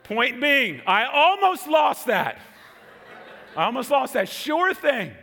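high, loud preacher's exclamation; emphatic shout. Real, not acted. Preacher to congregation on nearly losing out, emphatic shout. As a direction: drive the point home at full voice.

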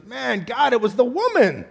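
high whiny excuse voice; Adam blaming Eve. Retold or imitated. Adam to God, blaming the woman in a high whine. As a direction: pass the blame in a put-on high whine. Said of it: "He goes".

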